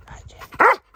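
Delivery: very high, loud doggy squeal; shrill-free high pet voice, big and loud. Real, not acted. Sudden squeal to the dog.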